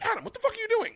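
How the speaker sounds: high-pitched shocked cry; mock disbelieving yell. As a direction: yelp at him in disbelief. Yelling at friend in disbelief.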